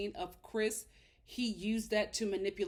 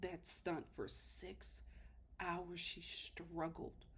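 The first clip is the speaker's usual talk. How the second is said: grave, hushed indignation; 5 semitones lower, 9 dB quieter than usual. Real, not acted. Host dwelling on Chris tormenting Paige, grave and hushed.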